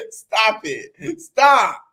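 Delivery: ordinary delivery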